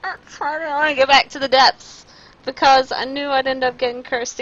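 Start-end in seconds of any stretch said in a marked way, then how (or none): 0.0-2.1 laugh-crying. Real, not acted. Laugh-crying over curse.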